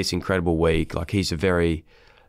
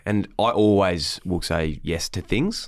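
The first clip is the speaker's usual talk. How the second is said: ordinary talk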